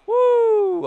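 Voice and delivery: loud, very high woo; drawn-out victory whoop; high celebratory whoop. Real, not acted. Player celebrates the lead, high celebratory whoop.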